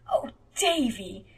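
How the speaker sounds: slow, choking back a laugh; holding back laughter. A narrator's reading. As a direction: try not to laugh. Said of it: "choked back an unholy shriek of laughter".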